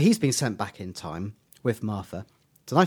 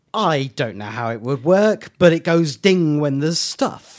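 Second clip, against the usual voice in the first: emphatic, louder imitation of a baffled writer; writer giving up; 4 semitones higher, 7 dB louder than usual. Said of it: "Stephen Moffat, the writer, going".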